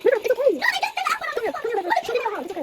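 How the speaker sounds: high, coaxing comfort voice. Real, not acted. Comforting River who is crying, high and coaxing.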